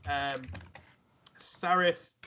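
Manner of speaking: ordinary talk, nothing marked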